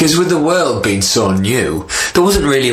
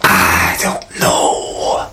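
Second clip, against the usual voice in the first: slow, whispered, lost snake; a dim snake, unsure; 4 semitones lower, much slower than usual. Acted.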